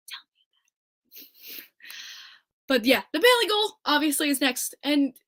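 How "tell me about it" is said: whispered, slow and soft; hushed whispered aside. Shown as a demonstration. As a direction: mutter it under your breath.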